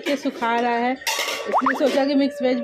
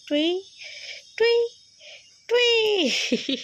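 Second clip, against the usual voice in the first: playful squeak; 11 semitones higher, much slower than usual. Real, not acted. Playful noise for the baby.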